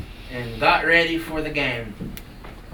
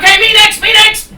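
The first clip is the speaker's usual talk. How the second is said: high, loud eager shout; very excited; 18 dB louder than usual. Acted. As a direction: shout it, bursting for a turn. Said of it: "he was so excited".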